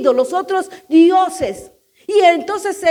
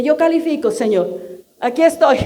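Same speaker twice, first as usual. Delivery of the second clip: prayerful response; 5 semitones lower than usual. Pretend. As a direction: say it humbly to the Lord. (respuesta humilde y baja)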